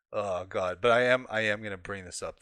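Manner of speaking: ordinary talk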